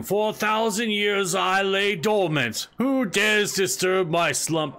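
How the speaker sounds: slow booming ancient beast voice; dragon voice. Pretend. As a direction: roar it like a waking dragon. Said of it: "come back, uh, screaming".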